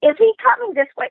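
high, fast, breathless question; excited mother. Retold or imitated. Daughter mimicking her mother seeing Cary Grant approach, high fast squeal. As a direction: squeal it like a starstruck mom. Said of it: "she goes".

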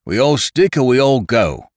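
high, loud, defiant outburst; hot cry. A narrator's reading. One of the men leaps up among the crew, crying out hotly. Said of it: "he cried out".

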